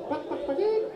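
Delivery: high, clucking "pak, pak, pak, pak"; chicken noise. Retold or imitated. Waiter answering the customer's question, mock high clucking. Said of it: "make chicken noise".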